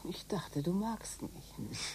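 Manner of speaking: unvoiced, slow tender whisper; soft whisper to her lover; intimate whisper. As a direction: breathe it softly to him. Lovers' secret talk.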